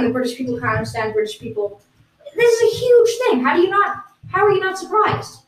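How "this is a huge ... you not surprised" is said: much higher and louder, hammed-up disbelief; mock astonishment. British guest demands the hosts act surprised, hammed-up disbelief. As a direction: play up the shock that nobody reacts.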